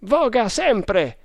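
high, drawn-out order; Tremal Naik commanding. A narrator's reading. Leader to oarsmen under pursuit, slow high command. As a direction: call the order firmly, stretching it. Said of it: "comandò Tremal Naik".

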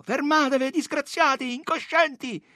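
high-pitched cry to stop; shouted warning. A narrator's reading. Policemen warning the attacking women, high strained shouting. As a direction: cry out to halt the mob. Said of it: "e gridavano".

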